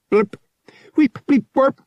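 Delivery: high, loud electronic blips; helpful droid bleeps. A narrator's reading. Droid replies helpfully to the pilot, bleeping. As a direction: chirp out droid noises, high and separate. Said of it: "the unit replied helpfully".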